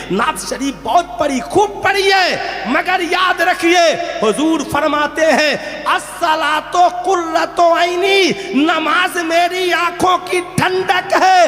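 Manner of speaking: high, loud, drawn-out declamation; full-force preaching. Real, not acted. Preacher proclaiming the Prophet's words on prayer, full-voiced and high. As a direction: proclaim each phrase slowly at a shout.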